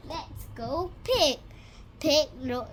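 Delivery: usual speaking voice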